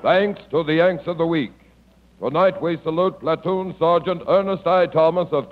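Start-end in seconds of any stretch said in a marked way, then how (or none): none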